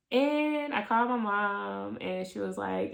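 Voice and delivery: ordinary delivery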